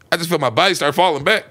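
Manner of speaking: usual speaking voice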